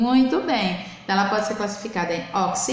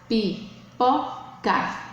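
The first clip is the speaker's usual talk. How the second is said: "pipoca" slowly split, pi... po... ca; syllable by syllable; much slower than usual. Shown as a demonstration. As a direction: sound out "pipoca" in three separate beats. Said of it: "formada por três sílabas". ("pipoca" devagar, sílaba por sílaba)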